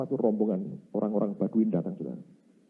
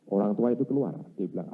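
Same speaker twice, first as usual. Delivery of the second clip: ordinary talk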